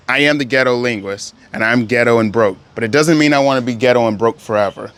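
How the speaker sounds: ordinary talk, nothing marked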